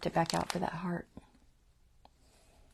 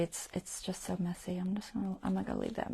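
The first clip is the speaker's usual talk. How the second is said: soft whisper; 7 dB quieter than usual. Real, not acted. Muttering about the threads.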